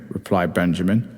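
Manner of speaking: usual speaking voice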